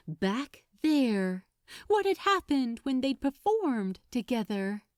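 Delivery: ordinary delivery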